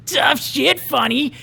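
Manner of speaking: high, jeering bully voice, laughing and shouting; sneering bad boy cackling at the loser; mocking bully. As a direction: taunt him like a cocky school bully. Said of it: "It was Roger".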